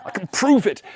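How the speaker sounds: high and loud, breaking into laughter; laughing old man. Acted. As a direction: giggle through his joy. Old man now happy.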